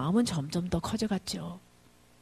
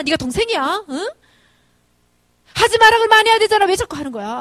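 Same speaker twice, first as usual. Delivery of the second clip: high, loud scolding that builds to a scream; angry yelling; 7 semitones higher, 9 dB louder than usual. Retold or imitated. Mother accusing son of taking his brother's money, angry yelling. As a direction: berate him, climbing to a full shout. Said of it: "소리 깨끗이 질렀더니".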